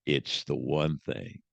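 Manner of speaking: a grizzled cowboy dropping his voice deep and slow; Jack Palance cowboy. Retold or imitated. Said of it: "He goes".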